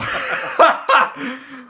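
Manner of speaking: loud high-pitched laughter. Real, not acted. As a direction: burst out laughing. Cracks up at the accent.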